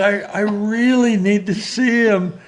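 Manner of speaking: high, loud, desperate pleading; emotional young man pleading to see the president; emotional plea. Retold or imitated. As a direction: beg the secretary to let you in. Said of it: "I was a little emotional".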